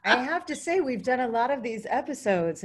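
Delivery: loud, high, gushing reaction; bursting with real excitement after the story; thrilled outburst; excited. Real, not acted. Host reacts to moving story.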